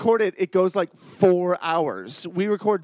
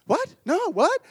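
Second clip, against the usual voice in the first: feigned innocence; 9 semitones higher, slower than usual. Retold or imitated. Feigned innocence in retold audition.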